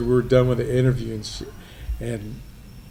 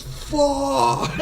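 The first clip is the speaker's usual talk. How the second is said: high pained squeal after the punch; pained yelp; 12 semitones higher than usual. Retold or imitated. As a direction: squeak it out high, like it still stings. Man hit hard in the arm.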